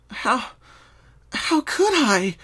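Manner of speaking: high, slow, stunned pony voice; a young mare whispering in horror at herself; shocked disbelief. Acted. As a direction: gasp it out as if you can't believe what you did. Pinkie in shock after nightmare.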